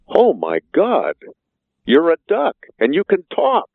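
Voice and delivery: gasping out the words as it sinks in; stunned bartender. Acted. Bartender stunned by talking duck.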